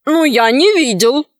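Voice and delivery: lightly voiced bandit chief; understated put-on denial; feigned innocence. A narrator's reading. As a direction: deny it with mild innocence.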